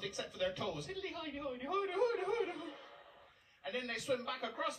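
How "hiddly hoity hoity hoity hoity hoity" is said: high, laughing mock Irish jig; Irish lilt. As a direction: rattle it off as a giggly Irish lilt. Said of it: "warm up a little as they go south".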